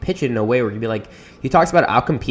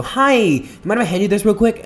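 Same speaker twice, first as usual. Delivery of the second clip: higher, beaming, overly cheerful ask; biggest smile; 8 semitones higher than usual. Retold or imitated. Vlogger handing a stranger a card, beaming cheerful ask. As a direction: plaster on a huge grin and chirp it. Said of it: "put on the biggest smile ever".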